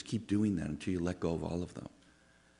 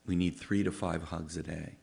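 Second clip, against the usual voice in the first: ordinary delivery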